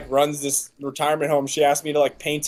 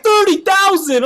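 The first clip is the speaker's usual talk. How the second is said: a shocked cry at a price tag; 13 semitones higher, 5 dB louder than usual. Retold or imitated. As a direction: blurt it out high and loud in disbelief. Shocked at the Bored Ape price.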